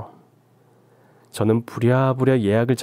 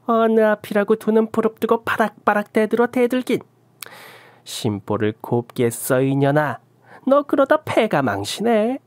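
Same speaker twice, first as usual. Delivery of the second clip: put-on scolding old shaman; 10 semitones higher than usual. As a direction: spit the curses down at her. Shaman scolds her back.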